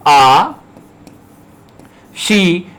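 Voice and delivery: आ and शी said apart, each drawn out; syllable by syllable. Shown as a demonstration. Teacher sounding out the word as he writes it, syllable by syllable. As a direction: sound out "आ… शी" with a long pause between. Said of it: "जहां रे का उच्चारण हो रहा है".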